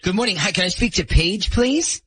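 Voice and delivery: normal talking voice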